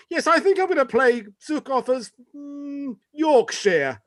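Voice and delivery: lightly acted actor pitching an idea; imagined Jason Isaacs. Actor proposing his accent at the read-through, imagined musing voice. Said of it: "you can just imagine".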